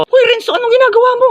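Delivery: high, loud sharp rebuke; shrill teacher scold. Acted. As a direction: catch the cheaters red-handed. Teacher catches cheating. (matinis at malakas na saway ng guro)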